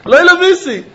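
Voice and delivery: Lo lovisi said high and loud, boldly declared; a firm high proclamation of lovisi; spoken out loud. Shown as a demonstration. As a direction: declare lovisi outright, pitch up. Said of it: "I'm speaking it out".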